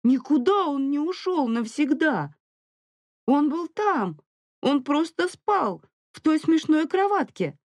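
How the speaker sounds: put-on very high child's protest; plaintive kid arguing back; upset little boy. A narrator's reading. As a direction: insist in a high-pitched small-child voice. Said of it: "захныкал Мило, испуганный поведением матери".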